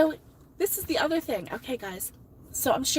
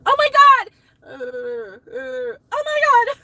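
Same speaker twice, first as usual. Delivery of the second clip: high, loud, drawn-out cries of despair; frantic yell; 12 semitones higher, 10 dB louder, much slower than usual. Retold or imitated. Retelling to viewers, a substitute teacher's loud frantic wail. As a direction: wail it like you're at the end of your rope. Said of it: "that's what I did".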